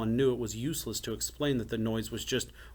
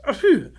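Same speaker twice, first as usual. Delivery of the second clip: loud, high acted sneeze; sudden sneeze; 13 dB louder than usual. A narrator's reading. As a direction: burst out with an achoo. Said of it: "sewer rat number one sneezed".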